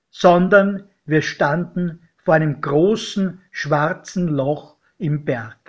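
ordinary delivery, nothing marked

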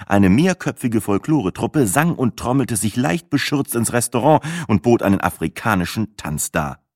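usual speaking voice

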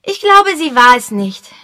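put-on high, loud firm boyish voice; a kid stating his verdict; decided boy. A narrator's reading. Boy Peter giving his verdict to the adults, high firm voice. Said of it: "bemerkte Peter entschieden".

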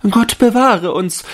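high, loud alarmed cry; a high frightened yelp from the ladies; young ladies crying out. A narrator's reading. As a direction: shriek it like startled young ladies. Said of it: "schrien die Fräulein".

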